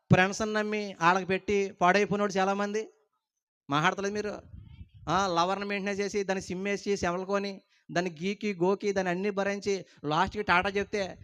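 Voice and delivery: ordinary talk, nothing marked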